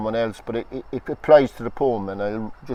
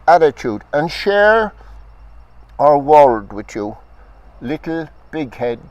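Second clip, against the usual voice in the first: slow, measured reading with long pauses between phrases; poetry reading; 6 semitones higher, 7 dB louder, slower than usual. Acted. Poet reading his ultrasound poem to the audience, measured recital. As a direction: let each image land, then pause. Poet reads The Alien aloud.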